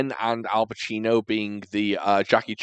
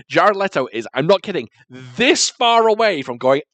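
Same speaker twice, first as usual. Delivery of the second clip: normal talking voice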